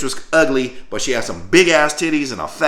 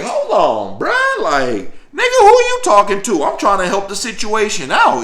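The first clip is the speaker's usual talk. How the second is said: high, louder indignant comeback; indignant retold retort; 9 semitones higher, 5 dB louder than usual. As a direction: push back at the sergeant, offended. Confronting the drill sergeant.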